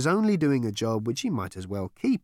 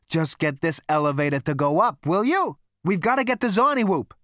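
raised, loud impatient command; urgent order; 8 semitones higher, 6 dB louder than usual. A narrator's reading. Zaphod ordering Marvin to fix the elevator, urgent. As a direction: push it out with mounting impatience.

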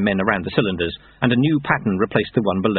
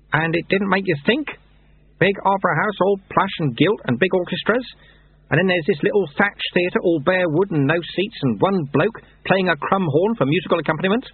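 higher-pitched mentor voice; knowing Lutze; 10 semitones higher than usual. Acted. Lutze teaching Lobsang about lies, knowing. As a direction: lead the pupil along with questions.

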